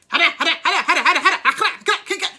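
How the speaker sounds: high, loud rapid-fire gibberish; mock foreign-language shouting. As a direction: imitate her shouting away in another language. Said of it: "but she was shouting".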